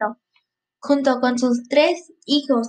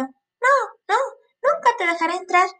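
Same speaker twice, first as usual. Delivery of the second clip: high, quick little pig voice; high piglet voice; 6 semitones higher than usual. A narrator's reading. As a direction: squeak it out like a piglet. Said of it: "le respondió".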